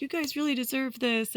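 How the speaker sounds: high-pitched imitation of an encouraging man; retold heartfelt praise. Retelling a man praising the new homeowners, high-pitched and encouraging. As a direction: lift the quote right up high. Retelling his warm congratulations.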